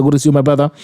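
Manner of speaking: normal talking voice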